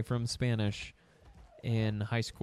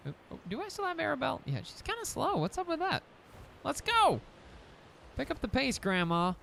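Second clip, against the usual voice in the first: high teasing voice; 13 semitones higher than usual. Acted. Teasing slow dino in high voice.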